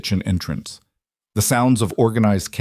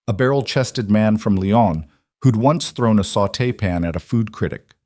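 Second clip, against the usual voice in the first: ordinary delivery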